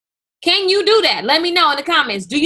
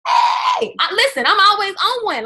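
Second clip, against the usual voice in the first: high, loud hype shout; 7 semitones higher, 4 dB louder than usual. Real, not acted. After quoting [name] to her viewers, a hype shout. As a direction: whoop it up, then insist. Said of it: "hype shout after lyric".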